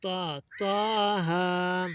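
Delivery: slow drawn-out त्वा then त्वाहा; patient corrected recitation; corrected reading. Shown as a demonstration. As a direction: model त्वाहा slowly after the correction. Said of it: "बेटा त्वा नहीं पढ़ना".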